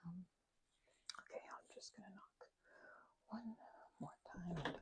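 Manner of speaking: whispered to herself outside the door; breathy whisper, not meant to be heard inside; hushed whisper. Acted. Vampire whispering to herself at a stranger's door.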